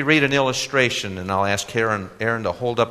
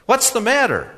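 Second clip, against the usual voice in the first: put-on much higher, earnest young man; eager young man voice; 13 semitones higher, 7 dB louder than usual. A narrator's reading. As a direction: naive youth, pitched up and louder.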